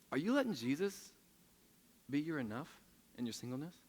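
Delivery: ordinary talk, nothing marked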